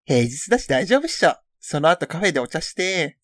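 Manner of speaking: casual schoolgirl read-out; teen girl chatter voice; gal voice read. Acted. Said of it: "ちょっとやらせていただいてましたけど".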